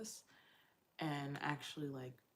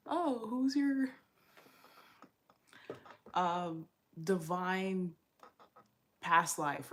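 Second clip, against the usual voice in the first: mock TikTok voice; 2 semitones higher, 7 dB louder, much slower than usual. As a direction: ham up a dramatic TikTok question, louder and slower.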